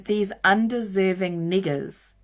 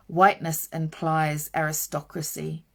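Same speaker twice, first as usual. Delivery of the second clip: usual speaking voice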